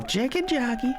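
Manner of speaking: very high, squeaky mock holler; playful yell. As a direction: holler it high without real volume. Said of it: "We're gonna yell".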